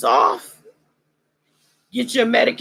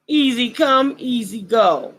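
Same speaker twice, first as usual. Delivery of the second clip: normal talking voice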